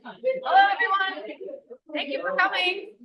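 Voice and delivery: high, loud call over the room; calling out to audience. Real, not acted. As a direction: project a drawn-out greeting to a crowd. Calling a chattering room to order.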